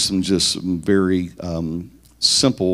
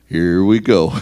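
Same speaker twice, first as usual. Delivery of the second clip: switching into I personality; 7 dB louder than usual. Pretend. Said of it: "now I'm to my I personality".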